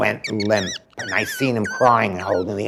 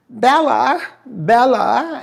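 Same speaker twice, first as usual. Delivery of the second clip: high, loud, sing-song call of her name; 12 semitones higher, 4 dB louder, much slower than usual. Retold or imitated. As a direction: call her across the beach in a high put-on voice. Host calls out to her.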